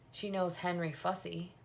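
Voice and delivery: ordinary delivery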